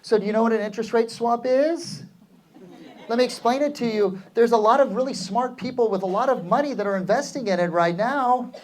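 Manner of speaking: every phrase rising high and unsure; upswing demo. Shown as a demonstration. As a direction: sound unconfident, lift each ending up. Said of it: "swing up with my voice like this".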